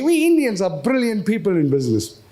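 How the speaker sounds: ordinary talk, nothing marked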